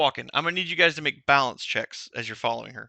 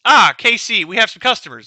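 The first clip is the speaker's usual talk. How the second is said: Lucky calling out; 4 semitones higher, 7 dB louder than usual. Acted.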